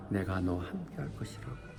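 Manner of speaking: very soft, low quoted promise; reassuring. Retold or imitated. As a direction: drop to a hushed, calm assurance. God's promise to Gideon.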